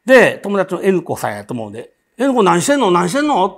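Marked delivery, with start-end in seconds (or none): 2.2-3.5 calling out loudly across the restroom; retold shout to her friend. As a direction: shout to a friend you can't see. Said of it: "って大声出したんです".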